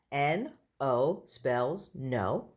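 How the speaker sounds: N O, each letter said slowly and apart; spelled out letter by letter. Shown as a demonstration. Teacher spelling a word to young pupils, slow. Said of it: "And we spell that".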